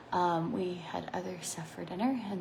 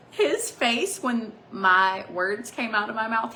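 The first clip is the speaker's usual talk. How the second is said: laughing; 2 semitones higher, 8 dB louder, slower than usual. Real, not acted. Laughing retelling of his reaction.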